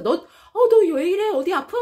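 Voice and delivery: loud, high startled "너 왜 이래?"; high loud worried exclamation; shocked concern. Acted. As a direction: react in shock at a friend's thinness.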